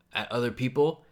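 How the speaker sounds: ordinary delivery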